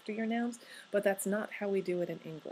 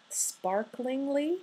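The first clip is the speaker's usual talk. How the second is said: drawn out; 5 semitones higher, much slower than usual. Shown as a demonstration. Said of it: "drawn-out adverb test word".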